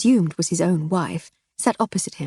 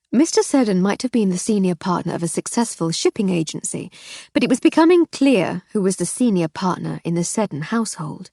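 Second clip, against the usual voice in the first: usual speaking voice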